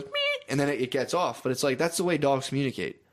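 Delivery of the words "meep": a small squeaky dog yelp, imitated; imitated little dog yelp; mock dog squeak. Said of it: "the dog goes".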